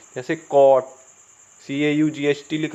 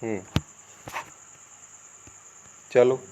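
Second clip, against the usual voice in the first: ordinary delivery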